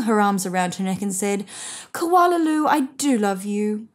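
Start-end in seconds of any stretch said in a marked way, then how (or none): 1.9-3.9 put-on a relieved mother holding her child close; mother's tender refrain. A narrator's reading.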